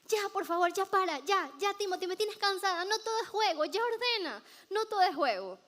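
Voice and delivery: high-pitched scolding big sister; furious sister. Retold or imitated. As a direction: scold the little brother, fed up. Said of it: "Se pone bravísima". (regaño agudo de hermana harta)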